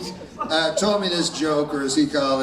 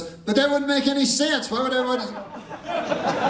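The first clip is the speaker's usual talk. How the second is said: high puzzled dog voice; a dog baffled by the offer; talking dog; 9 semitones higher than usual. Acted. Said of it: "the dog goes".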